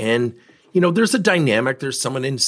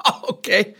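imitating a dismissive laugh, higher; mocking laugh; 11 semitones higher than usual. VP of sales laughing at the invited coach, mocking. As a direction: laugh him off with a high mocking chuckle. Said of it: "He starts laughing at me".

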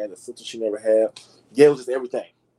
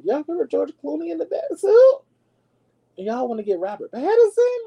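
much higher, louder, disbelieving; incredulous squeal; 5 dB louder than usual. Real, not acted. Vlogger mocks the Batman casting to viewers, high incredulous squeal.

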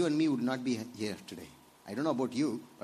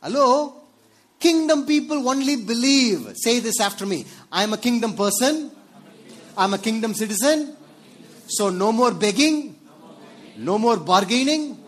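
high, loud, emphatic pulpit preaching; full-force preaching; 8 semitones higher, 10 dB louder than usual. Acted. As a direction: drive each phrase home to the congregation, high and loud.

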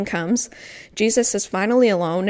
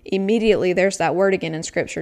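ordinary talk, nothing marked

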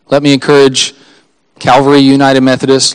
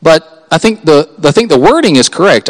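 ordinary talk, nothing marked

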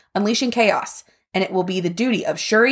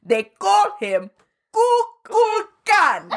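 mock Namor accent; slower than usual. Quoting the Namor line to cohost, hammed-up mock accent. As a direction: drag out Kukulkan in a hammed-up accent. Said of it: "a terrible accent".

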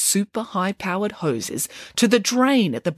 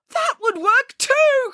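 very high, stretched-out delight; excited cry; 3 dB louder, slower than usual. A narrator's reading. Scientist hears housekeeper's better idea, excited cry. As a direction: draw it out high, thrilled. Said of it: "cried Archimedes".

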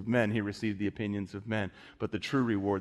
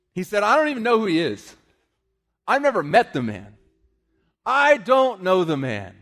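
1 preaching at full force; 7 semitones higher, 7 dB louder than usual. Acted.

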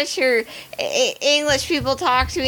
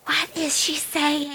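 slow, hushed mock stage whisper; 5 dB quieter, slower than usual. Mom to her daughter about the cashier, mock whispering. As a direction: imitate a slow, softened aside. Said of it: "they're whispering about as well as".

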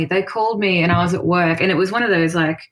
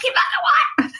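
very high squeal of disbelief; retold mind-losing shock. Told of winning the tour competition, losing their mind. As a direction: squeak it out, re-enacting utter shock. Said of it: "So I lost my mind".

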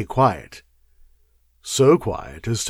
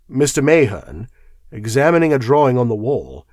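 plain reading voice

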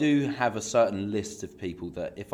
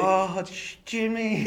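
high, besotted crooning of the name; hammed-up lovesick voice; weird swooning; 9 semitones higher, much slower than usual. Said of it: "Jack's got this real weird".

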